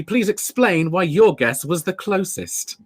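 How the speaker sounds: ordinary delivery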